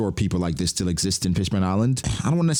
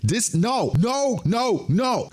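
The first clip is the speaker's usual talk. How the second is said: high, repeated panicked no's; mock panic; 13 semitones higher, much slower than usual. Elders panicking over the broadcast, high mock panic. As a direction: sputter no over and over in alarm.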